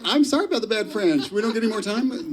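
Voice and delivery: high, fast, jokey sorry; playful apology. Real, not acted. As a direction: apologise in a light rush. Moderator apologises for time.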